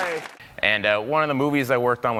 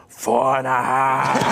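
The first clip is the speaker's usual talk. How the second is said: put-on slow, louder Austrian Schwarzenegger accent; Schwarzenegger-ish toddler; 2 semitones lower, 4 dB louder, much slower than usual. Toddler insisting on her age to dad in a Schwarzenegger accent. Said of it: "kind of a Schwarzenegger a little bit".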